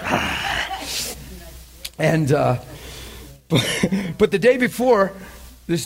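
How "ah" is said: breathy, voiceless dog noise; imitated dog noise. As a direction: imitate the dogs with pure breath. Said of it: "And they were like".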